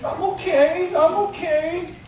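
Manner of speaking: put-on high, small child's voice insisting she's fine; little girl's voice. Pastor imitating the tearful girl, high and childlike. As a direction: pitch way up like the crying little girl. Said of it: "but what did she say?".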